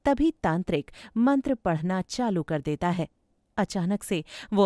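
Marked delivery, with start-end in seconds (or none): none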